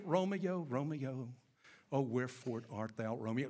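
low, hushed, longing Juliet; hammy stage actor. Retold or imitated. Preacher acting pining Juliet.

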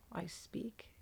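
quiet whispered translation; whispered gloss. Real, not acted. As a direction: whisper the English meaning aside.

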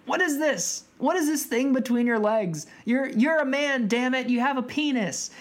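hammed-up exasperated accusation; indignant confrontation. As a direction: act out an incredulous demand. Acted confrontation from the film.

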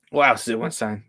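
normal talking voice

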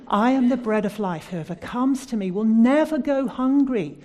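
loud, ringing declaration; proclaiming scripture. Acted. Preacher to congregation quoting Jesus, loud proclamation. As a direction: boom out the promise of Jesus.

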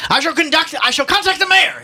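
high, loud pompous outburst; shouting. Acted. Ignatius threatening the policeman with the mayor, shouting. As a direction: shout a grand threat in outrage. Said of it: "Ignatius was shouting".

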